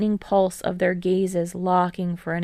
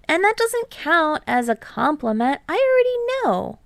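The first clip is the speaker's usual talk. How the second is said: Hinata, eager; 9 semitones higher than usual. A narrator's reading. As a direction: bounce up, brag a bit. Hinata voiced by narrator.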